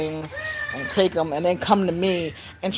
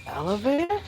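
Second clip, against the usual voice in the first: put-on questioning child; 7 semitones higher than usual. Child questions back, retold.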